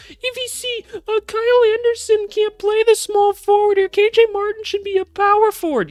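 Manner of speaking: very high-pitched, loud mocking fan voice; loud squeaky caricature of a complaining fan; A V C voice banter. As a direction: squeal it like an overexcited, clueless fan. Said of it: "my A V C voice banter".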